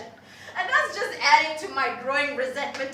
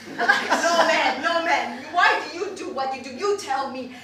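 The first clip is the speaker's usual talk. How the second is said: usual speaking voice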